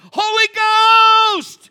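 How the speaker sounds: huge, high, drawn-out yell; full-throated stretched shout of praise; loud shout. Real, not acted. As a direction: cheer her on at the top of your lungs from the fence. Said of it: "Not quietly".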